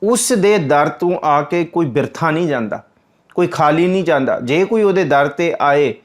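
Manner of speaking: ordinary delivery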